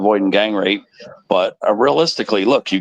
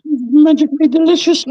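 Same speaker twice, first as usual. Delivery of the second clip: high squeaky leprechaun, louder; 4 dB louder than usual. Pretend. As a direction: squeak it like a cereal mascot. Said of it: "Sound like a leprechaun".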